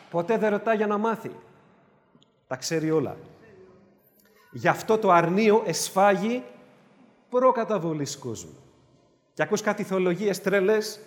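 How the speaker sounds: normal talking voice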